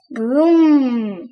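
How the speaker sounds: loud falling engine vroom. Acted. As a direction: rev off like a car pulling away. Taxi driving off.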